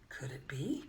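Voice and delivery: ordinary delivery, nothing marked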